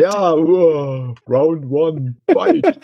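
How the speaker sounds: high, rising arcade announcer call; fight announcer. Pretend. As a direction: shout the fight start like a game. Mock fighting game announcer.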